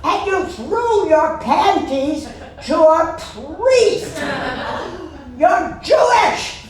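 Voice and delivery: mock outraged mom scolding her daughter; scolding mother. Mother scolding daughter after show.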